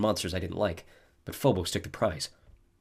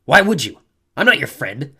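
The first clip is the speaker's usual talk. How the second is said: louder, scowling retort; girl scowling at an unwanted offer of help; 6 semitones higher, 11 dB louder than usual. A narrator's reading. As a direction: throw it back at him, hostile. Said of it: "She scowled".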